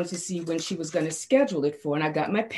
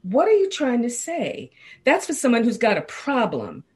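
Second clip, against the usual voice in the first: offended; 6 semitones higher, 4 dB louder than usual. Retold or imitated. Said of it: "then I got offended".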